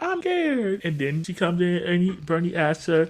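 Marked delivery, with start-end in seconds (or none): 0.0-0.8 put-on high, small scared-child voice; Brianna. Little girl confessing fear to her uncle, mock timid. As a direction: pipe it up like a frightened little kid. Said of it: "we see Brianna talk about".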